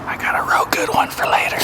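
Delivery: secret whisper. Real, not acted. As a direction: whisper it to the camera. Scheming aside to camera.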